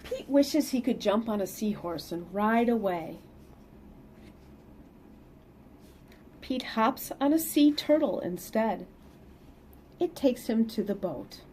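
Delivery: lively read-aloud storytelling; picture book reading voice; story narration. Acted. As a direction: read it to the kids with bounce.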